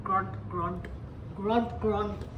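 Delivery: low, soft grunted words; rhinoceros grunt. Shown as a demonstration. Teacher showing how rhinoceros goes, low grunting.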